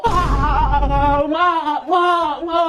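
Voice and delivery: hammed-up bawling; comic, broken "ma, ma" weeping; crying. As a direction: wail for mother in sobbing bursts. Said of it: "kok ngeri kali nangis".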